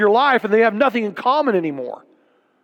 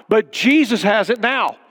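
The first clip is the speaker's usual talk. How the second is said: ordinary delivery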